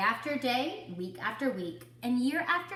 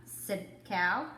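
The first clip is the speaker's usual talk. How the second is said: plain reading voice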